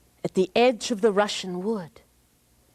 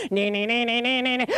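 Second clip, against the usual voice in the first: taunting cackle; 3 semitones higher than usual. Acted.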